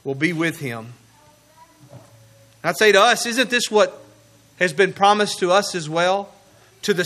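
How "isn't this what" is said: usual speaking voice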